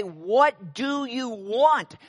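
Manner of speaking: slow, drawn-out annoyed demand; irritated demand. Retold or imitated. Preacher stops his sermon to confront the music minister, slow irritated demand. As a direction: stop mid-sermon and demand an answer, slowly.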